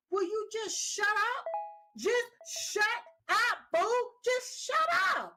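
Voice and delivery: high, mocking put-on voice telling him to shut up; exasperated shush. Vlogger mocking Zelensky, high exasperated shush. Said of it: "And I was like".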